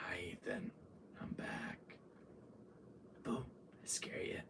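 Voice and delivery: hushed villain whisper; very soft, low stalker voice; creepy taunt. Retold or imitated. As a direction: whisper it right before a scare. Villain sneaks up on player.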